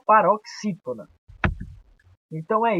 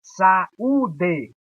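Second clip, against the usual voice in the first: "Saúde" spoken as sa, ú, de; drawn-out "Saúde", the ú standing on its own; split into syllables; 3 dB louder than usual. Shown as a demonstration. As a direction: separate each syllable of "Saúde". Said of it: "Vamos separar aqui".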